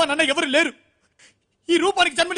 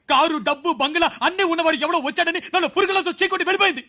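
ordinary talk, nothing marked

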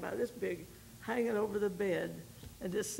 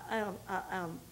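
ordinary delivery

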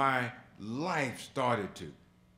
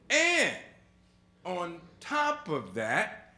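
higher, louder, railing at the heavens; angry at God; 7 semitones higher, 6 dB louder than usual. Retold or imitated. Son to God after his father died, a loud angry reproach. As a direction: rail at God, voice climbing and loud. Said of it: "I'm angry with God. I'm saying".

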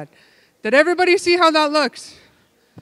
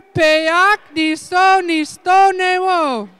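calling out; 5 semitones higher, 3 dB louder than usual. Real, not acted. Calling the game and counting loudly.

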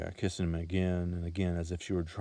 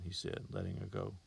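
ordinary delivery

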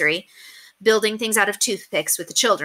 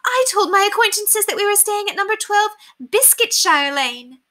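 high lady's voice, amazed; amazed high put-on lady; 14 semitones higher, 4 dB louder than usual. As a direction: let the realisation dawn, amazed.

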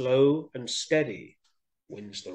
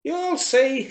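high mouse voice, confident retort; little mouse; 13 semitones higher than usual. A narrator's reading. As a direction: answer back as a tiny mouse. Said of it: "she said".

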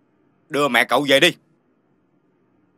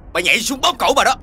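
angry threat; 6 semitones higher than usual. Acted. Angry man threatens visitor.

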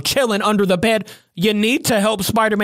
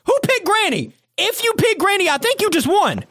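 excited; 9 semitones higher than usual. Real, not acted. Excited about Granny winning.

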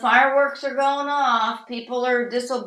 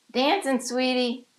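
ordinary talk

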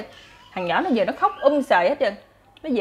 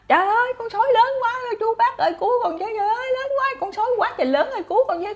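panicked scream; 12 semitones higher, faster than usual. Acted. Shepherd boy begs villagers to save him, terrified high scream. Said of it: "thằng nhỏ nó la làng lên".

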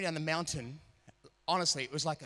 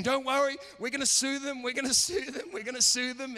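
much higher, louder, gleeful mock; mock British instructor; 10 semitones higher, 5 dB louder than usual. Ski instructor assuring the skiers, gleeful high mock. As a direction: crow it like a man itching to sue. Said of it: "he's saying".

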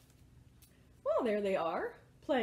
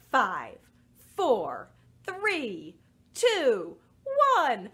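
spaced, falling, punched numbers; rocket countdown; 2 semitones lower, 5 dB louder, much slower than usual. Pretend. Leader counting children down to blasting off, emphatic countdown. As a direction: count down firmly with gaps between.

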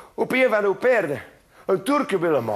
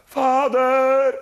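roepend; 5 semitones higher, 4 dB louder than usual. Acted. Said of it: "En hij zei".

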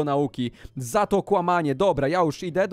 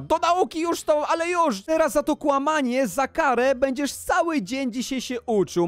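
high, insistent parental command; acted exasperated scolding, pitched up; angry ordering; 8 semitones higher than usual. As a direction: send the child off as punishment. Parent orders son to study.

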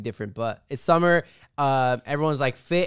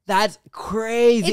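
drawn-out, higher-pitched amazement; amazed reaction; 5 semitones higher, 3 dB louder, much slower than usual. Retold or imitated. Recounting a reaction to Indians with Australian accents, amazed. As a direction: stretch it out in disbelief.

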